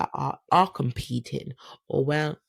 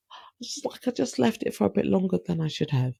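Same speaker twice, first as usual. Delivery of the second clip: sheepish; 6 semitones higher, faster than usual. Retold or imitated. Sheepish high confession.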